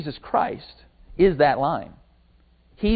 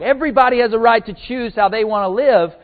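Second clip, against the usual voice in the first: higher-pitched mock insistence, only mildly raised; imitated loud protest; 5 semitones higher, 4 dB louder than usual. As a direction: mimic a vocal campaigner making a point. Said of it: "The people who scream the loudest".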